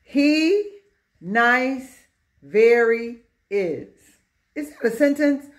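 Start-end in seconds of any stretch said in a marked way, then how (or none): 0.0-3.9 slow, every word set apart; word-by-word reading. Shown as a demonstration. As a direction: read it out one word at a time. Teacher reads scrambled example word by word.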